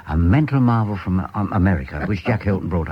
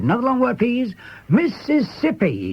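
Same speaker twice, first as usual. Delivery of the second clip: much higher, slow showman's announcing; imitating a music-hall mental marvel, higher and slower; showman patter; 13 semitones higher, slower than usual. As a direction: play the stage mind-reader working the crowd. Mind-reader asks audience.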